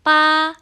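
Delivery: high, level, loud "Pa"; "Pa" stretched on one high pitch; first tone. Shown as a demonstration. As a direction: hold "Pa" steady up high. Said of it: "nada satu".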